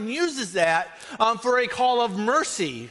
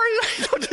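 high laugh; 13 semitones higher than usual. Real, not acted. Laugh after mock apology.

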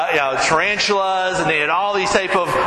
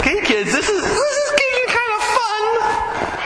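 forced, laughing bravado, pitched way up; fake bravado; slower than usual. Pastor to kids while the snake squeezes, strained laugh. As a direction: pretend it's fun while you panic. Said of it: "act real tough and brave".

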